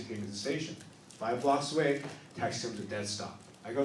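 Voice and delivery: ordinary talk, nothing marked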